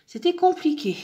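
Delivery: high, loud exasperated groan of a word. Real, not acted. Telling viewers the dark background was a pain, exasperated. Fond sombre, peinture difficile.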